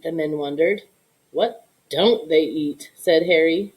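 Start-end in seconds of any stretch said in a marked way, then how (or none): 1.3-2.9 low, slow amused reply; Harry's wry answer. Acted. Harry answering his soldiers, low and slow. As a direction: drop low and slow, knowing.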